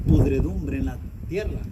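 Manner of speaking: normal talking voice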